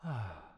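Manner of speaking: a small patient sigh. Acted. Butler waking a sleepy lady.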